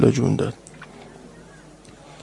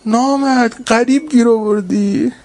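lamenting rebuke; 9 semitones higher than usual. Acted. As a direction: cry out at the killer in grief. Rowzeh, cursing the attacker. (نهیب سوزناک با صدای بلند)

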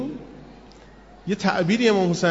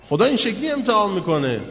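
usual speaking voice

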